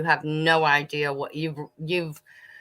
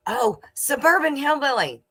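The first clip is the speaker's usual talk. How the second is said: excited realization; 9 semitones higher than usual. Real, not acted. Remembering to tell a viewer something.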